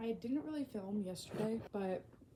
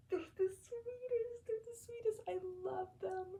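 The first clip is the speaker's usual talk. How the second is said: very high, crying gush; tearful squeal; 11 semitones higher than usual. Real, not acted. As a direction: squeak it out, overcome with love. Gushing about the kids.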